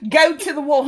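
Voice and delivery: hammed-up Jon Snow rallying the troops; try-hard northern accent. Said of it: "this Jon Snow accent".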